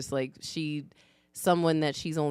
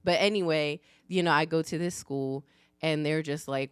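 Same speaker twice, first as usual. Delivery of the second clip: usual speaking voice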